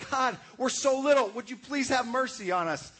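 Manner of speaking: high, loud desperate plea; crying out. Retold or imitated. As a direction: cry out to God for mercy. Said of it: "Amos cried out to God".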